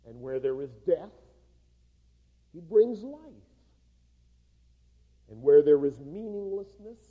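a preacher drawing the moral for his congregation, booming; preaching at full force. Acted. Preaching the point of the joke.

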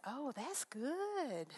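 slow, drawn-out contented reply; slowly delighted elderly preacher; pleased old pastor. Retold or imitated. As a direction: savour each word like a kindly old pastor. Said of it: "and he nods and he says".

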